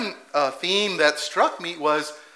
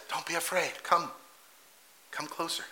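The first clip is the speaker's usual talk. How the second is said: much softer, gently drawing him in; tender invitation; 11 dB quieter than usual. Retold or imitated. Jesus inviting fearful Peter closer, soft and tender. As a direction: coax him closer, softly.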